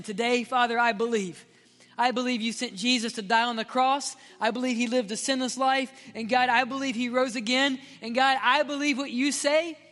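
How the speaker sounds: loud, rising preacher's prayer; preaching prayer at full force; fervent prayer. Acted. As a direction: pray with mounting conviction.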